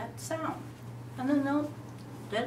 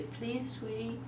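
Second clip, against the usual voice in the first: gentle, much softer request; sweet parent; 11 dB quieter, slower than usual. Retold or imitated. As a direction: ask it tenderly. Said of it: "do you say".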